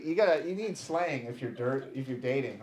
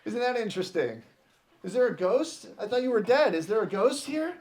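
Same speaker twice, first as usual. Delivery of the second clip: high, loud sarcastic taunt; mock gloating; 9 semitones higher, 7 dB louder than usual. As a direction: crow at someone caught out, drawing out the mockery. Gloating call to the ghoster.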